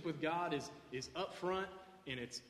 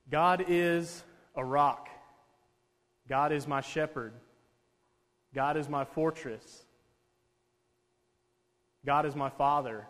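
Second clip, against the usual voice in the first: preaching declaration; 5 dB louder than usual. Real, not acted. Slow emphatic faith declarations.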